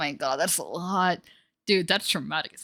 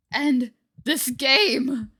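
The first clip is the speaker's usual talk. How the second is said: slow plea; 5 semitones higher, 5 dB louder, much slower than usual. Acted.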